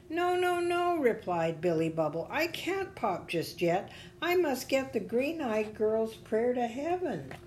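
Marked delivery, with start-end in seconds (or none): 0.1-1.0 very high, tiny character voice; little bubble protesting. A narrator's reading. Bubble refuses in story. 2.3-7.3 high, earnest character voice; determined little bubble. A narrator's reading. Bubble pleads his mission in story.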